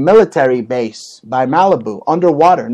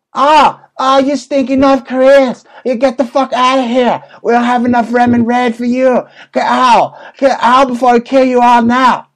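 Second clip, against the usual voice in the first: higher, put-on caricature voice ordering people out; mock official; 7 semitones higher than usual. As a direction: bark it like a cartoon border guard. Mocking Chinese officials ordering out.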